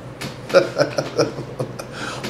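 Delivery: a soft run of quick chuckles. Real, not acted. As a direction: chuckle quietly to yourself. Chuckles after announcing the record title.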